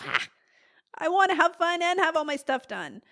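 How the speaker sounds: a playful sigh of wanting it all; mock longing whine.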